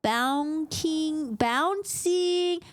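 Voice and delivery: very slow, drawn-out sounding out; haltingly decoding long words; struggling to read. Acted. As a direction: stretch each syllable like a beginning reader. Struggling to read the inscription aloud.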